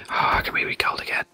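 breathy whisper. Real, not acted. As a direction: say it under your breath. Whispered aside.

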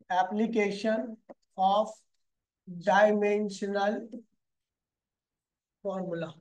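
"application of dimensional formula" spelled out word by word, very slowly and softly; slow dictation. Shown as a demonstration. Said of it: "तो application of dimension formula".

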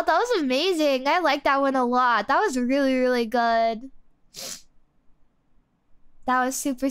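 usual speaking voice

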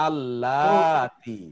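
আল্লাতি with the middle "লা" drawn out long; long vowel plural. Shown as a demonstration. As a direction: hold the "লা" in আল্লাতি, then finish on "তি". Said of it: "বহুবচন হলো আল্লাতি". (আল্লাতি-র "লা" লম্বা টেনে বলা)

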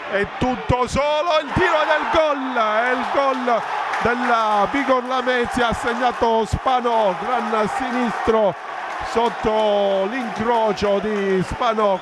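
rising excited goal call; breathless italian goal commentary. Real, not acted. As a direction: erupt as the goal goes in. Goal scored, commentator erupts.

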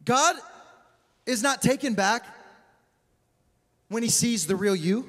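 ordinary talk, nothing marked

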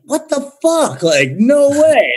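high, slow stunned disbelief; retold shocked exclamation. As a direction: stretch out the no way in shock. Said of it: "And I'm like".

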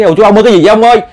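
high, loud alarmed cry; panicked wife calling her husband, high and loud. Retold or imitated. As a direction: call out to her husband in fright.

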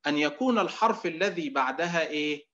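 ordinary talk

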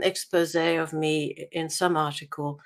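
ordinary talk, nothing marked

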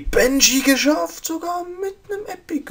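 high, loud excited squeal; thrilled squeal. Real, not acted. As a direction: squeal with joy at the loot. Thrilled by epic loot.

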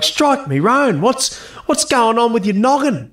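high, hammed-up old bloke voice; playful outback grump impression; mock cranky old Aussie. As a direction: bark it like a grumpy grandpa. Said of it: "It would have been like".

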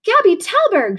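put-on a frightened girl screaming for help; panicked scream. A narrator's reading. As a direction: shriek it down the phone in a panic. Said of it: "she shrieked".

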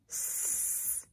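hissed "S" with no voice; stretched s. Shown as a demonstration. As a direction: stretch out the "S" hiss. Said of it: "stretch and shrink see".

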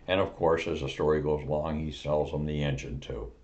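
ordinary talk, nothing marked

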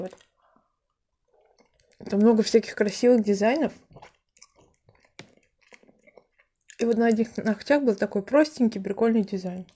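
chewing between words; talking while chewing. Real, not acted. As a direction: mouth partly full. Seen 3: Bites pastry at -2.0; lips closed, cheeks working while talking.

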